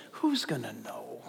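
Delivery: low sly whisper; tempter's whisper. Acted. Satan whispering temptation to the congregation, low sly whisper.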